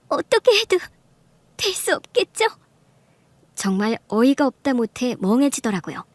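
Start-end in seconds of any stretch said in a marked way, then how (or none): 0.1-2.5 very high, broken-up weepy question; tearful. Acted. Mistress crying about never being family, tearful. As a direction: ask it haltingly through acted tears. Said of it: "울기 시작하는 겁니다".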